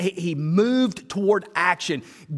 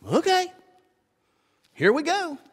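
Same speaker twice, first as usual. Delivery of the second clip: eager yes; 10 semitones higher than usual. Retold or imitated. A willing yes to God.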